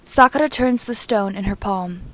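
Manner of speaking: usual speaking voice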